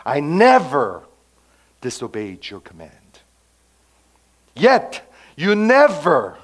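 never hit loud, then Yet you never stretched slow and high; stress on never. Acted. Older brother accusing his father, hammering never. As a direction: lean hard on never each time. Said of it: "You never use the word never".

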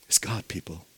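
soft whispered emphasis; hushed awed whisper. Real, not acted. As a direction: lean in and whisper it.

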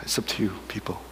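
whisper. Acted. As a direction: drop to a hushed whisper. Pastor repeats line, hushed.

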